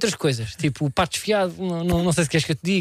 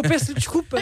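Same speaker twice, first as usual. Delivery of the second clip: flustered apology; 6 semitones higher, slower than usual. Retold or imitated.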